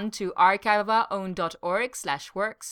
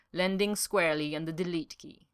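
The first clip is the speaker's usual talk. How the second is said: usual speaking voice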